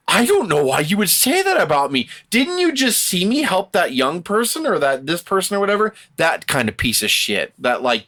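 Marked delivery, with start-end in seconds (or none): 0.1-5.9 higher-pitched mock wounded innocence; mock protest. Podcaster mimicking a bully who gets called out, mock protest. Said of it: "they're like".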